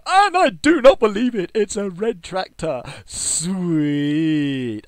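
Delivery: real triumphant shout at finally getting the tractor; ecstatic shout. Real, not acted. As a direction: burst out with the prize of a lifetime. Opens package, gets wanted tractor.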